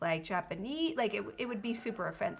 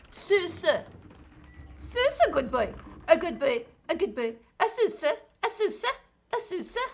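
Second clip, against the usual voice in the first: higher, cooing dog talk; 5 semitones higher, 5 dB louder than usual. Real, not acted. Talking to a dog.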